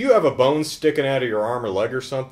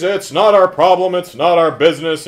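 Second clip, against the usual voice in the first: loud, insistent mock argument; arguing; 6 semitones higher, 9 dB louder than usual. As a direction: argue it emphatically, voice raised. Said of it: "And he argues".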